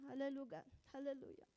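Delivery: ordinary delivery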